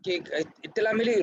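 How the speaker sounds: ordinary talk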